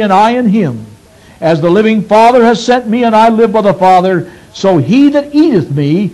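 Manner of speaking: measured, solemn Bible reading; scripture reading. Acted. Preacher reading scripture to the congregation, solemn.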